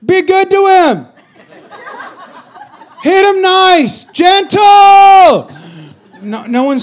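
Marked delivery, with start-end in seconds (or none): 0.0-5.5 high, loud mock plea to be gentle; pleading the player be treated nicely; mock gentle fan. Fan in the stands begging gently, hammed up. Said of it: "no one from the stands screams".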